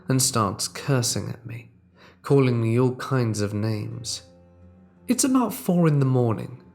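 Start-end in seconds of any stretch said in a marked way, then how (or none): none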